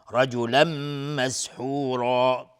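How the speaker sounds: مسحورا with a slow, heavy, full-mouthed را; tajwid demo. Shown as a demonstration. Teacher showing the class the heavy ra, slow and loud. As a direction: stretch مسحورا, thick heavy ra. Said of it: "را حکمش استعلاء".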